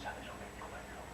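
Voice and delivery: hushed whisper building suspense. Acted. Storyteller whispers to the child before the climax. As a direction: whisper it to keep the child hanging. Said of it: "suspense whisper to child".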